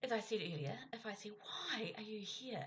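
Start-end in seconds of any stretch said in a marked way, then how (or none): 1.3-2.6 frustrated, judging question falling at the end; judgmental tone. Shown as a demonstration. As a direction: stress on "Why", high and slow. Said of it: "it could have a judgmental tone".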